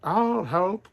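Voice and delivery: cooing. Acted. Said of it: "cooed a pigeon".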